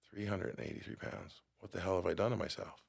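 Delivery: soft, stunned self-reproach; dismayed hush. Retold or imitated. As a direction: say it under your breath, shocked at yourself. Shocked at the scale reading.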